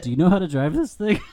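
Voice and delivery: high, quick little fish voice; goldfish voice. Acted. Telling the tank joke, voicing the goldfish high and quick. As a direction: squeak it out like a nervous fish.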